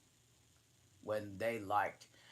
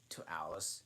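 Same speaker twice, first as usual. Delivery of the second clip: usual speaking voice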